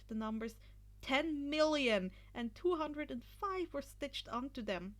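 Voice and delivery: plain reading voice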